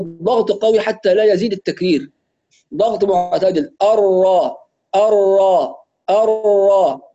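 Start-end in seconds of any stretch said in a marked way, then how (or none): 3.8-7.1 الراء heavy and doubled, the last one drawn out slow. Shown as a demonstration. Teacher demonstrating heavy doubled الراء. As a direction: press الراء moderately, full heavy ra. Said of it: "وهكذا يسمع صوت الراء".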